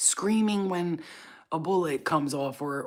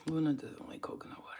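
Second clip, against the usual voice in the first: whispered aside; 10 dB quieter, faster than usual. Real, not acted. Muttering about the dog.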